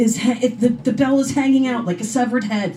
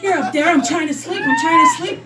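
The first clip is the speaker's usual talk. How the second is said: frustrated outcry; 7 semitones higher than usual. Acted. Cookies calling at night.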